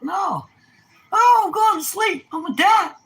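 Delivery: put-on high squealing kid voice; mock whiny kid. Mimicking a kid begging to stay up, high squealing whine. Mocking kids' loud complaint.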